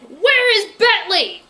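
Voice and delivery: high, loud, slow yell. Acted. Berkeley yelling at the villain for Bentley, loud slow yell. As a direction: yell the question at him. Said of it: "Berkeley yelled".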